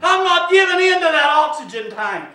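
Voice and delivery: forceful declaration from the pulpit; defiant preaching. Real, not acted. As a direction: declare it against the sickness.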